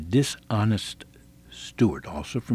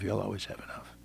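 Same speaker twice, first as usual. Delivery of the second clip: normal talking voice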